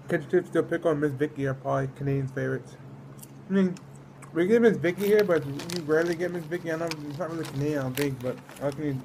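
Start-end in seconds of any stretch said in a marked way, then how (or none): none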